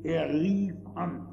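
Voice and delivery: "rief" drawn out slow and falling; er "rief" an, the calling stretched; stress on rief. Shown as a demonstration. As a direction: dwell on "rief" slowly. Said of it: "das heißt brüllen, schreien".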